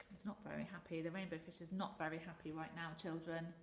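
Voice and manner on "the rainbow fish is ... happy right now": plain reading voice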